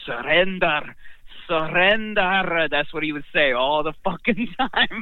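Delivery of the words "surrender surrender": slow drawn-out Scottish "surrender"; imitating a Scotsman; Scottish accent. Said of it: "He said".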